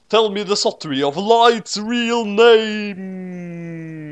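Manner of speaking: high, slow drawn-out demand; dramatic demand. A narrator's reading. Ray asks Naomi for the tale, slow high demand. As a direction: stretch it out dramatically.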